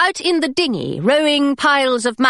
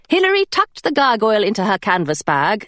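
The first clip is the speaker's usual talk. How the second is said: usual speaking voice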